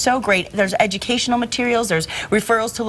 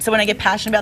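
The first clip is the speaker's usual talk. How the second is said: usual speaking voice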